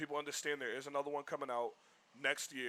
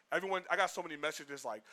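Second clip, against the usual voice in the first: ordinary talk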